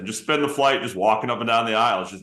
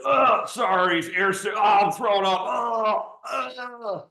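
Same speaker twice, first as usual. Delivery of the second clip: high strained mock throwing-up voice; hammed-up queasy groans; fake retching sick voice; 12 semitones higher, slower than usual. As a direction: ham up being sick behind the door. Faking airsickness in bathroom.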